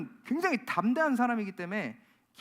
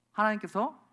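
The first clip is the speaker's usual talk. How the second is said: normal talking voice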